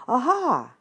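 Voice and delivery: loud triumphant Aha!. Acted. Three friends announcing a kidnapping, loud. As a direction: shout the Aha out. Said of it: "very loudly".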